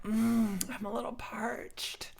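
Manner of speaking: hushed, breathy aside; mock-thirsty whisper; parched whisper. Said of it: "and I'm like".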